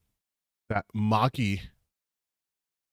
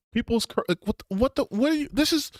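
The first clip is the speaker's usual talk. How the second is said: incredulous; 11 semitones higher, faster than usual. Real, not acted. Streamer reacting to absurd fan complaints, sputtering high in disbelief. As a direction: voice jumps way up in disbelief.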